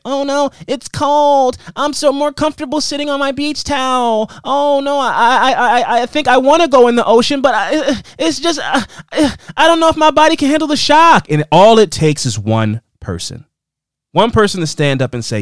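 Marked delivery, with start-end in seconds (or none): 0.0-11.3 high, stretched-out whiny hesitation; mock reluctant bystander. Host imitating timid people at the water's edge, mock reluctant. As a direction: stammer and dither in a high put-on voice. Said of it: "Everybody's standing back".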